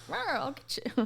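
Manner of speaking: soft, growly mock leprechaun; throwaway creature threat, half under the breath. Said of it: "like a le-, Leprechaun or some".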